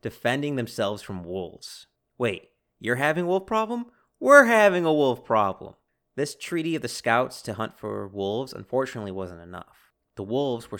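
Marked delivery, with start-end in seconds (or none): 2.2-5.7 high, loud, incredulous soldier voice; mock soldiers comparing notes, half amazed; imagined soldiers. Soldier to enemy soldier in the wolf-filled forest, incredulous and loud. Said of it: "I can just imagine a German dressed soldier".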